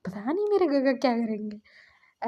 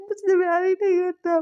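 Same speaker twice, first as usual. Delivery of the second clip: high, louder, crying wail; sobbing; 7 semitones higher, 4 dB louder than usual. Retold or imitated. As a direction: wail it through tears. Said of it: "बोल के वो रो रहे थे". (रोते हुए ऊँची आवाज़ में)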